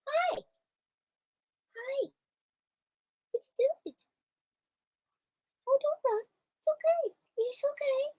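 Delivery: calm the startled cat gently up high; soothing the cat. Real, not acted. Owner soothing the spooked cat, high soft coo.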